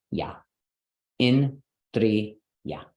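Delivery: "In, dri, ya" split into separate syllables with pauses; "In, dri, ya" clearly broken apart. Shown as a demonstration. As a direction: sound out "In", "dri", "ya" one at a time. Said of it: "So this would break in dri".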